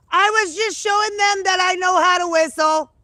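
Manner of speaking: high, loud holler; calling out. Real, not acted. Explaining the whistling to someone off mic, high shout.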